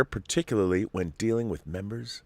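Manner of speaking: ordinary talk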